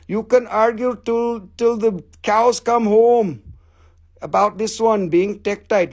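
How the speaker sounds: thick put-on foreign accent, every word separately pronounced; broken-English foreign accent for a sales pitch; put-on foreign seller accent. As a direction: play the haggling vendor with a foreign accent.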